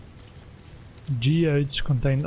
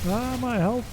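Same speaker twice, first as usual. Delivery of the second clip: high, startled "Ah, my health."; surprised; 10 semitones higher, slower than usual. Real, not acted. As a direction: catch your breath at the bad news. Notices the health bar dropping.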